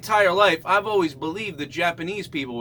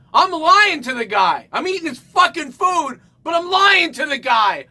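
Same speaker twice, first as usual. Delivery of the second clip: shouting; 9 semitones higher, 5 dB louder than usual. Real, not acted. Outraged rant at himself.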